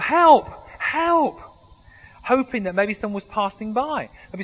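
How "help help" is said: high, loud cries for help; yelling for help. Acted. Man hanging off a cliff yells for help. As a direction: shout up the cliff for rescue. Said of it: "he began yelling for help".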